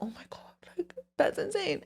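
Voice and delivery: breathless whispered realization; awed whisper. Retold or imitated. Recalling the moment the advice finally clicked, whispered. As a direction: hush it like it just hit you.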